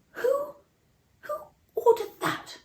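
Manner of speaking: the king cries out in disbelief; shocked outcry. Acted. King learns of the decree.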